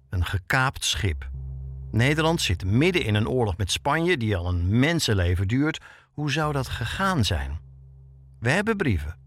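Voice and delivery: ordinary talk, nothing marked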